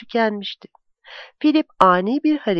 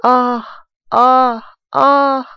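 rising moans, louder and higher; woman moaning behind the wall; woman's moans; 4 semitones higher, 5 dB louder, much slower than usual. A narrator's reading. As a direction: moan in pleasure, building. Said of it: "İnliyordu".

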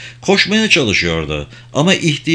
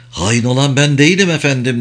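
ordinary delivery, nothing marked

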